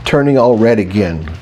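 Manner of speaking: ordinary delivery, nothing marked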